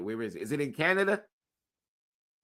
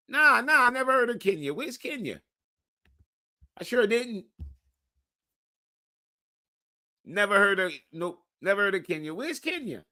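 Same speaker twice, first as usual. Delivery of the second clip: mock yelling denial; 10 semitones higher, 8 dB louder than usual. Loud mocking denial.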